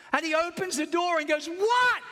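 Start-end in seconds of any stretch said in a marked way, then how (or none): 1.5-2.0 high, loud alarmed question; startled what. Retold or imitated. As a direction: burst in thinking something's wrong. Startled brother opens door.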